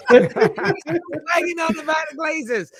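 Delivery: put-on very high, slow shouted Northern Irish kid voice; Northern Irish accent. Son mocking dad about the Glazers, shouted Northern Irish accent. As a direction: stretch it out like a cheeky Northern Irish boy. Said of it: "In his thick Northern Irish accent".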